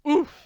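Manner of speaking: sharp high grunt from a slap; knocked breathless grunt; winded oof. Acted. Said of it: "so hard that the Count goes".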